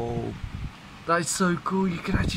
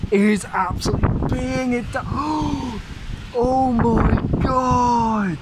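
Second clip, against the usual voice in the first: excited; 5 semitones higher, slower than usual. Real, not acted. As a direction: shout it out in awe of the storm. Storm arriving, rain hammering down.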